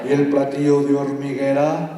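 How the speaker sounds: slow, rhythmic Spanish poem reading; dramatic Spanish verse reading; poem recital. Acted. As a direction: chant the verses like an incantation. Reads a Spanish poem aloud.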